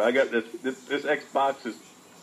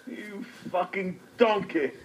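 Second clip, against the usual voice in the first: chef insult impression; 6 semitones higher than usual. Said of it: "I like Gordon Ramsay".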